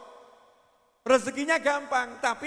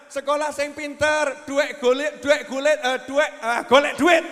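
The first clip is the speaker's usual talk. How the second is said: loud, nagging parent hammering golek duit; mock parents barking orders at a child; imitating loud nagging parents; 4 semitones higher, 11 dB louder than usual. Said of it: "Kalimatnya ibu-ibu dan bapak-bapak".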